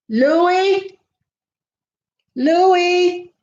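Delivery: high call of the dog's name. Real, not acted. Owner calling her dog Louis from across the room, high.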